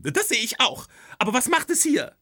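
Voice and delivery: very high, loud indignant wife; wife confronting Rick about the skeleton, very high and loud; outraged wife. Acted. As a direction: demand an answer at full pitch. Wife's shrill outrage.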